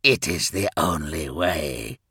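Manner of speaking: low-voiced, insistent appeal; Ferrus urging. A narrator's reading. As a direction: urge it from low in your range. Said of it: "urged Ferrus".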